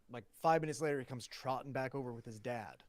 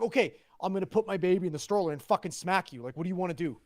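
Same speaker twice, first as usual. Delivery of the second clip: loud, higher-pitched exasperated challenge; retold angry threat; 5 semitones higher, 10 dB louder, faster than usual. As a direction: re-enact squaring up to another parent.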